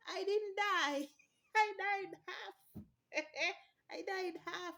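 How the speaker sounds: high, slow, laughing through it; laughing disbelief. Real, not acted. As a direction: giggle at the absurdity. Laughing at anesthesia memory.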